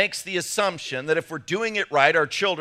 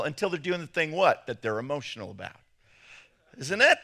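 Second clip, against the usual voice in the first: ordinary talk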